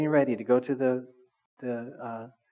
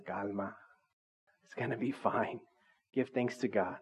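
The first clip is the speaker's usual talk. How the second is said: much softer, calming the flesh down; soothing spirit voice; 6 dB quieter than usual. Pretend. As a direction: settle someone gently like a calm inner voice. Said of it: "And the Spirit says".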